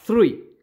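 "three" with a lisped Spanish z, like "thrui"; pronunciation demo. Shown as a demonstration. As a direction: start "three" with a th sound like the Spanish z. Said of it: "como que fuera así".

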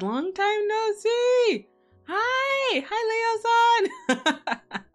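very high, squealing hello; excited squeal. Real, not acted. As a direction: squeal with delight. Squealing greetings to raiders.

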